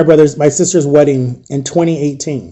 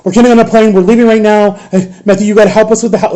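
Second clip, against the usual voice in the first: retold frantic mom, pitched higher; imitating a flustered mother taking charge; all over the place; 5 semitones higher, 3 dB louder, faster than usual. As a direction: rattle off scattered orders in a raised pitch. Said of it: "she's all over the place".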